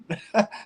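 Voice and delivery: short burst of real laughter; amused laugh. Real, not acted. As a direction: laugh at your own story. Amused at own retold story.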